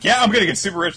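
high, loud mock glee; gleeful girl. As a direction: imitate a girl cheerfully boasting. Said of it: "where she's just like".

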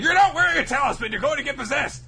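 very high, loud, fast alarm; hammed-up high frantic yell; panicked shout. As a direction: shriek the warning in panic. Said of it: "Chaco's like".

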